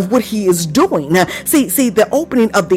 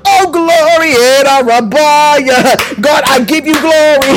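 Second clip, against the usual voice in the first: higher, driving exultant preacher voice; fervent preaching; 4 semitones higher than usual. Real, not acted. Preacher stirs congregation between bursts of tongues, fervent preaching. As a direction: punch phrases and stretch out the praise.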